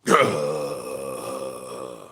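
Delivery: long, low imitated tornado growl. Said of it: "The damn tornado start growling".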